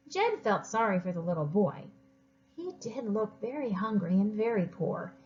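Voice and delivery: usual speaking voice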